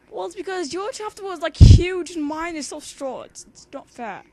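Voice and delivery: mock-indignant whine at her friend; it's not fair, loudly; loud whiny complaint. Real, not acted.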